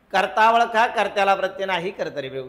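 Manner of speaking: brisk rattle through the rule, dropping at the end; quick rule drill. Shown as a demonstration. Teacher drilling the class on the rule, quick recital. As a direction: run through it quickly in one go.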